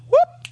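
high, loud whoop; sudden high-pitched whoop; quick whoop. Acted. Preacher imitates lame man popping up, high loud whoop.

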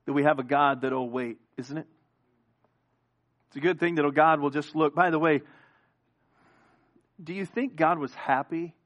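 usual speaking voice

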